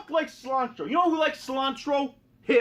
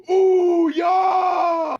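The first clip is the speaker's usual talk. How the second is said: loud, higher cheering yell; excited wrestler; 5 semitones higher, 7 dB louder than usual. Retold or imitated. Roman Reigns offered cilantro, loud excited yell. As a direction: whoop it up like a hyped wrestler. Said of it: "And he's like".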